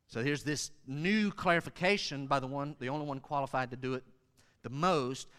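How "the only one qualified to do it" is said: ordinary delivery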